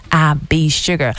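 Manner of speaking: low, loud, slow radio DJ intro; DJ announcer voice. Acted. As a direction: announce your name like a radio DJ. DJ introduces herself on air.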